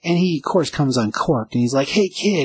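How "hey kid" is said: high-pitched mock yell; retold yell. Shop owner after kid in the store, mock yelling. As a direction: call out high, holding back the volume. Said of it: "he's yelling at the kid".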